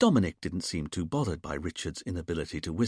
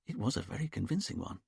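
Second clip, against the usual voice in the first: plain reading voice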